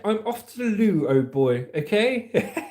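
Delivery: high, hammed-up posh English; posh English accent. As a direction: play a plummy English toff. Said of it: "like a posh English accent".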